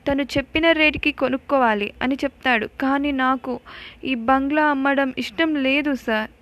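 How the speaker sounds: pouring out his grief to the officer; tearful complaint. Acted. Anand tells the officer who ruined his family, tearful. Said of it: "Anand ఏడుస్తూ ఉంటాడు".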